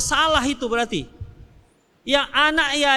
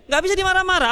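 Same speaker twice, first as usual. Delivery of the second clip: ordinary talk, nothing marked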